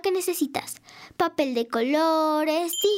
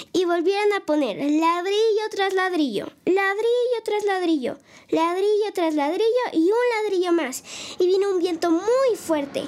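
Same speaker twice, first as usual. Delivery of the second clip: put-on storytelling to kids. Host reading a story to children at home, lively narration. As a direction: tell the tale to little listeners with gusto.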